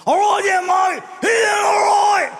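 loud, high, slurred Australian bloke; raspy drunk Aussie. Retold or imitated. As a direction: bellow it like a drunk Aussie pirate. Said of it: "He had more of a raspy voice".

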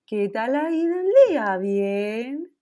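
put-on high sing-song voice for a toddler; mock baby talk. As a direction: coo the question down at a little kid. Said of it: "Pero no le digas". (voz aguda y cantarina de hablarle a un bebé)

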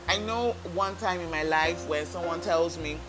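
usual speaking voice

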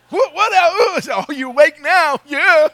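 loud, high, fake-innocent surprise; loud feigned surprise to wake a sleeping wife; mock loud wake-up. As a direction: jolt her awake pretending nothing happened. Loudly waking wife in car.

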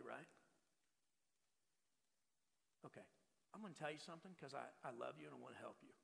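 a father gently talking his son through a mistake; soft fatherly heart-to-heart; calm talk to son. Shown as a demonstration. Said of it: "I might even say something like this".

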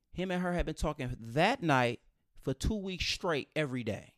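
ordinary talk, nothing marked

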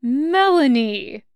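whiny wounded betrayal. Acted. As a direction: whine the name like he's been wronged. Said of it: "wounded betrayal ... whiny and high".

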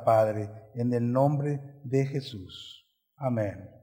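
soft, low prayer voice over the church. Acted. Pastor leads the congregation in prayer, soft and low.

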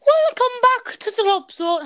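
high, loud show-host intro; announcer voice. Acted. Show intro, said yet again.

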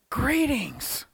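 put-on lightly stately, old-fashioned greeting; a hint of a grand formal hello. Preacher voicing Jesus's formal greeting with mock stateliness. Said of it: "we don't talk like that".

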